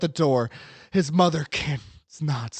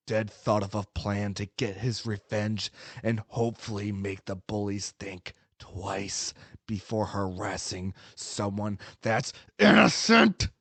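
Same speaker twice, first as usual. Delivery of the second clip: plain reading voice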